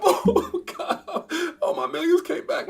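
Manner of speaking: high, gushing mock joy; imitating an emotional win; mock overjoyed. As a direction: act out a giddy trader getting his millions back. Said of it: "I'm gonna get emotional".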